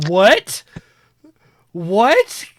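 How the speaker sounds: loud, high startled double question; shocked. Retold or imitated. Dad to his four-year-old at a restaurant, loud shocked disbelief. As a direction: react as if you misheard something shocking.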